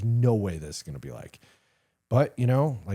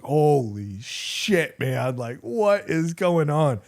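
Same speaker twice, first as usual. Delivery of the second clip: stunned; 6 semitones higher, much slower than usual. Retold or imitated.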